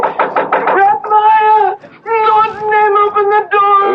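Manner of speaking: high, loud, desperate calls through the door; frantic shout. Acted. Leia shouts at the temple door to Reb Meyer, frantic and high. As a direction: yell to be let in.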